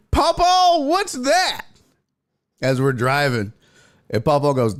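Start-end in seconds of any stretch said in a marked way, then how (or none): 0.1-1.6 put-on high, loud curious child; little kid voice. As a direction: pipe up like a small boy. Kid voiced asking grandpa.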